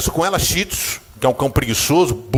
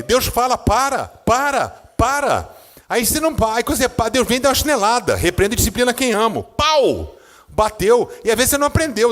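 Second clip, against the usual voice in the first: higher pitched, pressing sermon delivery; fervent preaching; 8 semitones higher than usual. Acted. Pastor warning the congregation about God's discipline, fervent preaching. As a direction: drive the lesson home from the pulpit. Preacher drives home the lesson.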